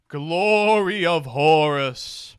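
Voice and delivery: loud, drawn-out card title; grand announcement. Real, not acted. Player announces a new Horus card, grand and drawn-out. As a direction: announce it grandly, stretching the words.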